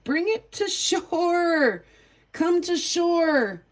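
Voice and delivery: slow, high calling to the water. Retold or imitated. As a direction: call out slowly to the kid on the lake. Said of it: "and was like".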